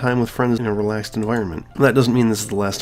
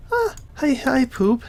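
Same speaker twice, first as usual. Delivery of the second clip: put-on much higher, cheerful girl voice; Sayori voice; slower than usual. Player reading Sayori greeting her friend, high and bubbly. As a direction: chirp it like a sweet, bubbly girl.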